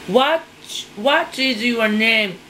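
ordinary delivery

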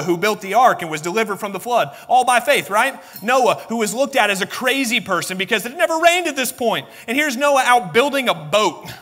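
normal talking voice

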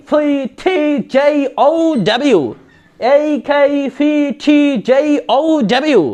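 slow letters, puffed "টি", gliding "জে" and "ও"; chant-like list, each letter drawn out and arched; pronunciation drill. Shown as a demonstration. Teacher modelling letters to practise, slow and exaggerated "ডব্লিউ" without l. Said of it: "এইভাবে করে বলবেন".

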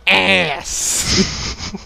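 usual speaking voice

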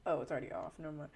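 ordinary delivery

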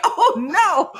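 half laughing in alarm. Retold or imitated. Rider chased by teenage bikers, laughing dismay. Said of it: "And I'm like".

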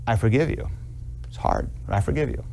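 low, soft, halting wife's reply; acted hurt forgiveness. Wife to husband who hurt her, softly forgiving. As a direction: lightly play a wife forgiving quietly despite hurt.

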